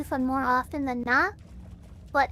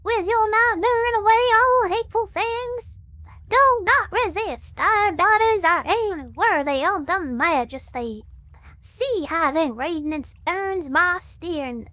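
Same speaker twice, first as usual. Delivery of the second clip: Southern Baptist preacher; 2 semitones higher, 4 dB louder, much slower than usual. Shown as a demonstration. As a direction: preach it like a wound-up Baptist zealot. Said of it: "like, preacher voice".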